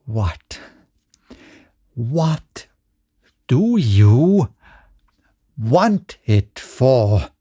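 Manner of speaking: gasping, halting, broken-up words; gasped. A narrator's reading. George gasping when asked for money. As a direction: choke it out in shocked fury. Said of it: "he gasped".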